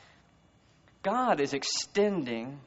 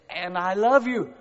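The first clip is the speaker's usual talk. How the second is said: lovestruck suitor; 6 semitones higher, 7 dB louder, slower than usual. Acted. Movie suitor declaring love.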